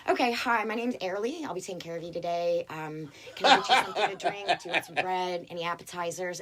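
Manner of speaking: quick chirpy American server voice; American waitress accent. Pretend. Waitress faking her own American accent for diners. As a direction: roll out the greeting like a real American waitress. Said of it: "do my American accent for you".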